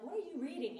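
insistent question. Retold or imitated.

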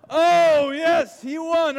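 loud, high burst of delight; excited cheer. Real, not acted. Leader cheering as a kid wins the game, excited shout. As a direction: shout it like the winner just grabbed the bacon.

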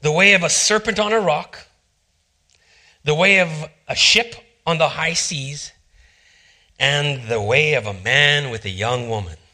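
measured scripture recital; scripture reading. Acted. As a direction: read the verse with reverence and wonder. Reads Proverbs aloud.

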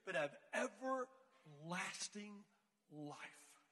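very slow, fading to soft; solemn verse ending. Retold or imitated. Girl ending her Bible verse, slow and solemn. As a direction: draw out each word, ending hushed.

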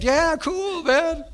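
put-on a teen brother's easygoing reply; cool teen. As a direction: toss it off like a chill teenager. Teen brother voiced casually.

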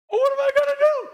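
high, rushed comic panic; mock panic. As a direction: play a frantic worrier throwing up their hands. Acting out panic in the fire.

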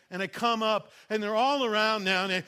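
ordinary talk, nothing marked

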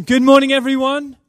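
big booming hello to the room; loud greeting. Real, not acted. Guest preacher greeting the whole church, loud and bright. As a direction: call out good morning to everyone.

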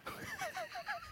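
soft, very high-pitched laugh; high, hushed giggle. Real, not acted. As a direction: wheeze with quiet high laughter. Laughs at the kid's question.

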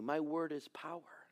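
soft intimate whisper; whispered promise. Retold or imitated. God assuring his people, whispered. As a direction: breathe it like a secret.

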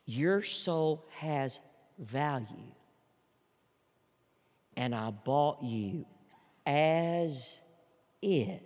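very soft and slow, each word held; voice of Jesus. Acted. Jesus speaking to a soul knee to knee, soft and slow. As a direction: speak as Jesus, tender and unhurried. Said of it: "And Jesus says".

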